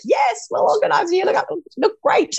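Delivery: high, bubbly quoted reply; mock excited reply. Host reenacting her eager reply to the guest. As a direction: gush it in a perky voice.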